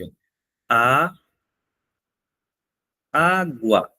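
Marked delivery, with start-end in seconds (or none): 0.7-1.1 "a" said alone and held; isolated syllable. Shown as a demonstration. Teacher showing the class an example, "a" as a separate syllable. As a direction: sound out "a" as one separate syllable. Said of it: "Por exemplo, eu digo aqui".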